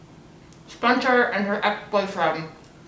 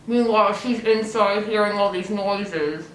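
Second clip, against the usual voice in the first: ordinary talk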